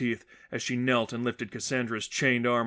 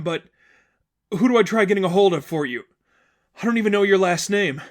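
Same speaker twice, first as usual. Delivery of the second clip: fast, higher worried voice; urgent and anxious; 6 semitones higher, 6 dB louder than usual. Acted. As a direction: rush out help, flustered. Sadie urgently offering help.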